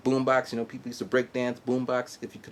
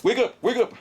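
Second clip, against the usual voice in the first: 1 yelled wake up; 12 semitones higher, 4 dB louder than usual. Retold or imitated.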